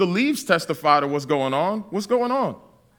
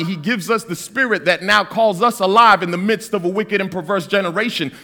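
ordinary talk

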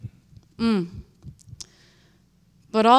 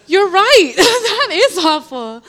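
high, loud, laughing through the words; a laughing "You're right" that is higher and louder than plain; laughing agreement; 10 semitones higher, 11 dB louder, slower than usual. Retold or imitated. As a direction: crack up while agreeing.

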